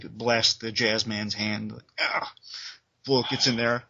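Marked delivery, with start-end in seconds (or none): 2.0-2.3 yelp. Retold or imitated. Gun blasts the hand.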